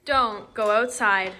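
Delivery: firm, drawn-out warning; loud slow warning. Acted. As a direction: stress every word slowly. Teacher warning the class.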